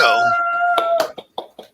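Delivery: very high squeaky heyo; squeaky greeting. Real, not acted. Guest greets with silly high call.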